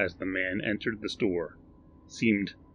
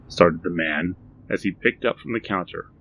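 plain reading voice

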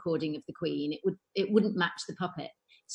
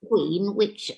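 the Queen. Retold or imitated. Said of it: "Who are you doing".

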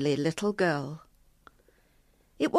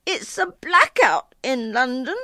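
put-on high, raised child's voice; shouting girl; 7 semitones higher, 5 dB louder than usual. A narrator's reading. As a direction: shout back as an indignant little girl. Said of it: "Dilys shouted".

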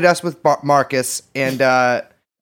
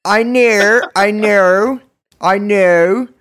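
loud, higher, hammed-up Australian accent; Australian accent attempt; 4 semitones higher, 5 dB louder, much slower than usual. Shown as a demonstration. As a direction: try a broad, exaggerated Australian twang. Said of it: "I'm gonna do an Australian accent".

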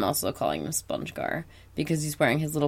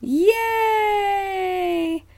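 put-on kids gang shout; much slower than usual. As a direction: shout it like a bunch of cheering kids. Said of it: "a kid's gang vocals".

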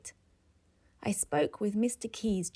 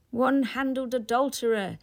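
crying out the phrase, louder and higher; delighted cry; 4 semitones higher, 6 dB louder than usual. Acted. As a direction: savour the phrase with glee. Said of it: "the professor cried".

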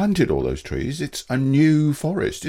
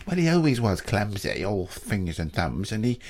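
shifty son; 5 semitones lower, 4 dB quieter than usual. Acted. Son voiced by narrator.

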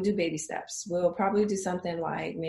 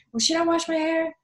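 nervous client; 8 semitones higher, 6 dB louder than usual. Retold or imitated. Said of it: "I'm nervous".

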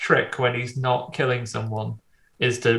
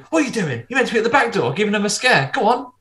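bossy nudge; 8 semitones higher, 3 dB louder, faster than usual. Retold or imitated. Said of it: "he comes up and goes".

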